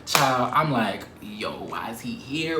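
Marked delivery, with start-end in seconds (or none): 1.2-2.6 slow unvoiced whisper. Drunk student whispering in panic about the department head.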